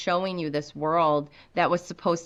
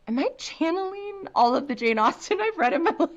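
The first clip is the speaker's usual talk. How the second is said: high-pitched, amused self-quote breaking into laughter; playful high voice, laughing at yourself; mock-innocent comeback; 11 semitones higher than usual. Acted comeback to the editor.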